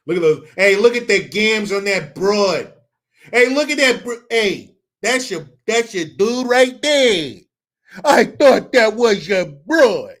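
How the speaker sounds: loud bro voice pointing at the hands; heckling buddies at a game; loud guy at the game. Pretend. Drunk fans at a game, loud heckling.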